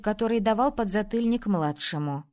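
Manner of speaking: usual speaking voice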